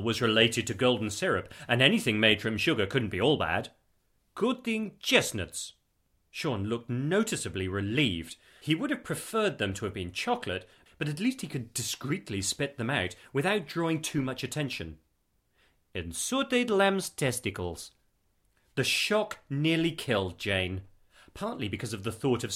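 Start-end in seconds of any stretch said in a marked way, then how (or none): 4.3-5.7 slow, higher-pitched Eastern European voice; deliberate Eastern European explainer; heavy Eastern European accent. A narrator's reading. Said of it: "in a heavy Eastern European accent". 15.9-17.9 slow, higher-pitched Eastern European voice; deliberate Eastern European explainer; heavy Eastern European accent. A narrator's reading. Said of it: "in a heavy Eastern European accent".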